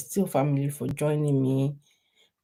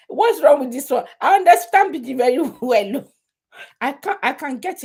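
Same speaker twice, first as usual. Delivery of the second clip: laughing; 8 semitones higher, 7 dB louder than usual. Real, not acted. Laughing while claiming Pidgin.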